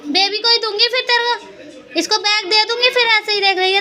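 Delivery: mother nagging her daughter to get up and do homework; high-pitched scolding. Real, not acted. Mother scolding small kids.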